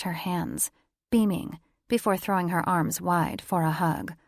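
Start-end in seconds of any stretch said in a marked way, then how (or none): none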